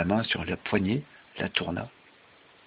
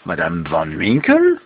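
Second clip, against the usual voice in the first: puzzled repetition; 2 semitones lower, 8 dB louder, slower than usual. A narrator's reading. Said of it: "répéta la femme".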